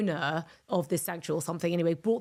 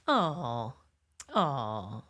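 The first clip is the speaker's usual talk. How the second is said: low, tender "aww" twice, going lower; soppy aww; 8 semitones lower than usual. Shown as a demonstration. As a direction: melt over something cute. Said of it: "make you go".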